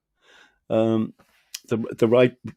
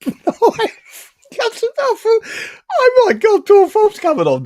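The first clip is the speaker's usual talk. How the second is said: laughing; 9 dB louder, slower than usual. Real, not acted. Excited retelling of guest appearing.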